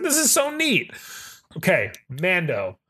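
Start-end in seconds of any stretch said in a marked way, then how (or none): 0.0-0.9 high, delighted gush; excited gush. Retold or imitated. Fan recalling the Kyber scene, delighted gush. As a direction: squeal it like a thrilled fan. Excited quote of own reaction.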